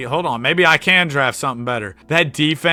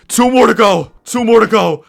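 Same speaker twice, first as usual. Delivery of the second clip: loud, high cheer; jubilant shout; 10 semitones higher, 11 dB louder than usual. Real, not acted. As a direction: cheer the countdown to victory. Celebrates a perfect season.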